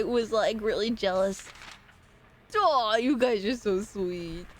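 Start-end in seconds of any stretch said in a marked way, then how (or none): 2.6-4.5 cooing. Real, not acted. Cooing at sweet chat messages.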